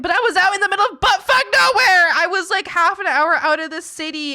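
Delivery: high and loud through laughter; laughing. Real, not acted. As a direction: crack up while telling it. Laughing at her situation.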